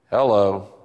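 hello dropped deep and short; low-pitched hello. Shown as a demonstration. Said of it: "all the ways you can say hello".

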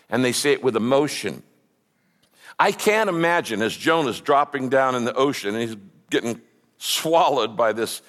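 ordinary talk

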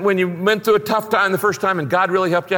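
ordinary delivery

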